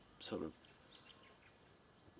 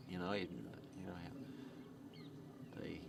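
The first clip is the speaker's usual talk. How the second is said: ordinary delivery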